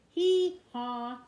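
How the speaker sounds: loud braying hee-haw like a donkey. Acted. The donkey in the song braying, loud. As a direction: bray it out, loud and high.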